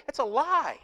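ordinary delivery

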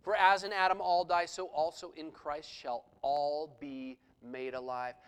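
loud, emphatic preaching of the passage; scripture reading. Acted. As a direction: proclaim it to the congregation. Quotes scripture again.

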